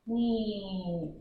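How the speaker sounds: a quiet dragging zoom. Shown as a demonstration. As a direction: let the zoom sound drag. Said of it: "I'm dragging".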